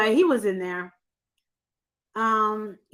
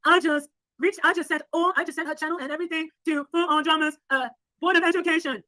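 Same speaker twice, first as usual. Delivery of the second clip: mocking voice impression; 3 semitones higher than usual. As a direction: mimic her speech to make fun of her. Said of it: "the way you do her voice".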